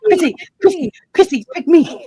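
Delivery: high whiny pick-me voice; eager kid begging. Retold or imitated. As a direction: beg like a kid in class.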